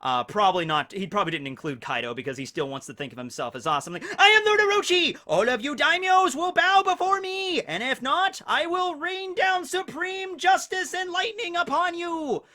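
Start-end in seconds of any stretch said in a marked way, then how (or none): 4.2-12.4 booming high villain proclamation; dark lord. Retold or imitated. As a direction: proclaim it like a gloating tyrant. Hammed-up villain boast as Orochi.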